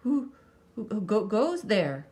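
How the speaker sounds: stammering frightened croak. A narrator's reading. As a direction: stutter it out, scared. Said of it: "croaked a third".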